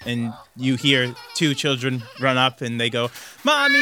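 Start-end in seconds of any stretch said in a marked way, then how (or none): 3.4-3.8 put-on high, loud child's cry of Mommy; excited child call. Children greeting their mother, a high excited shout. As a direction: call out like a little kid running to mum.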